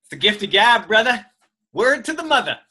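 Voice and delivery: loud, high, playful brag; big it up with swagger; hyped boast. Real, not acted. Co-host brags to his partner, loud and high. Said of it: "You speak too quickly".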